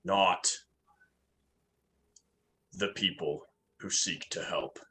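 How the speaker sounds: firm, emphatic start with long weighty pauses; solemn in-character declaration. Acted. Urag condemning the captives before striking, firm and solemn. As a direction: pronounce it like a warrior's creed, easing at the end.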